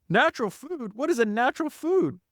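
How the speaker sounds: high-pitched mock bafflement; confused. Ben puzzled by John's natural foods, mock confused. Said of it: "He looked even mo-, more confused".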